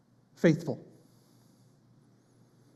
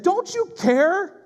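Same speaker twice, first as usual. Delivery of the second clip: desperate shout; 10 semitones higher, much slower than usual. Retold or imitated. Disciples wake the sleeping Jesus, desperate shout. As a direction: cry out to the teacher, stretching each word. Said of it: "have looked to heaven and said".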